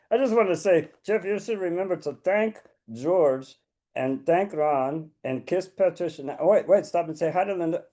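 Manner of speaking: a hint of a pushy little puppet dog. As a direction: play the cheeky puppet pup interrupting. Puppet dog Rufus butts in.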